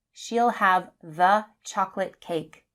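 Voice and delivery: THE as "thuh" before chocolate; short THE. Shown as a demonstration. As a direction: say THE with a short vowel. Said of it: "the with a short sound".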